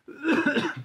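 sharp cough on coffee; choking cough. Real, not acted. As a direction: cough as if coffee went down wrong. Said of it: "I'm choking on my own coffee".

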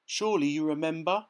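Dorothy shouting to the wizard. A narrator's reading. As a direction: call out indignantly. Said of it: "shouted Dorothy".

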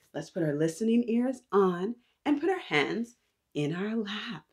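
plain reading voice

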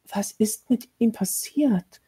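slow, fearful whisper. A narrator's reading. Mary asking the lady about the boy, frightened whisper. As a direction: barely dare to breathe the question. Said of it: "wagte Mary zu flüstern".

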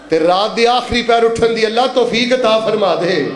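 normal talking voice

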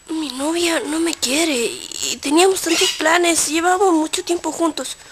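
usual speaking voice

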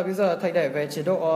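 usual speaking voice

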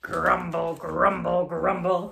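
ordinary delivery

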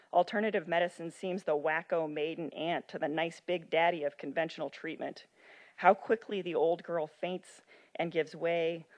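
usual speaking voice

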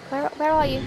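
ordinary talk

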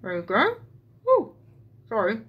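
talking with a needle between lips; needle in mouth. Real, not acted. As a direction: mumble round a needle held in the mouth. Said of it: "I've got a needle in my mouth".

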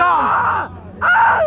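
high scream of fear; scared shriek rising very high; real terrified scream. Real, not acted. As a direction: scream out in fright. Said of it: "for screaming".

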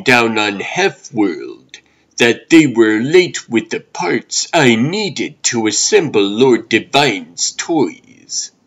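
a tired toysmith grumbling over late parts; hint of a fussy old toymaker; weary old toymaker. Toymaker muttering over his work.

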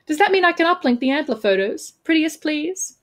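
high, wheedling request; acted cajoling; playful pleading. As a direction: coax them in a high, cheeky voice. She begs to share photos.

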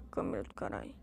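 low unvoiced grumble under breath; muttered curse. Real, not acted. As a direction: curse quietly to yourself. Annoyed at camera bug. (xingamento resmungado)

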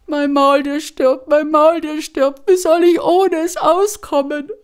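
high, loud lament, voice cracking with despair; wailing Maria. A narrator's reading. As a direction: cry out in high-pitched grief over the mule. Maria wails over dying mule.

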